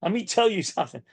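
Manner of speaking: bossy coach. Shown as a demonstration. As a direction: fire it off like a pushy coach. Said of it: "sometimes a coach has to do that".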